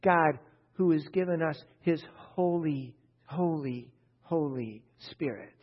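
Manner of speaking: Holy repeated, each falling and weighing down; emphasis on Holy. Shown as a demonstration. Preacher showing the congregation Paul's emphasis, stressing Holy. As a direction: lean hard on Holy, Holy, Holy Spirit. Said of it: "Like that, with emphasis".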